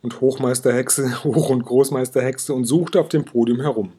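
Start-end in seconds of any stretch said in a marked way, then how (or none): none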